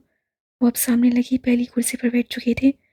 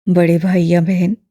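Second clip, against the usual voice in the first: put-on low, louder elderly man, gently probing; lower, fuller old gentleman; old imam voice; 6 semitones lower, 6 dB louder than usual. A narrator's reading. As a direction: voice the old man, kindly and curious.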